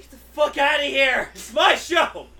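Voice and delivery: yelling, jealous brother voice; jealous shout. Retold or imitated. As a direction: shout him off the stage. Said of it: "His brother just comes in".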